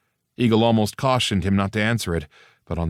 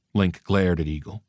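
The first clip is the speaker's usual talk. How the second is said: ordinary delivery, nothing marked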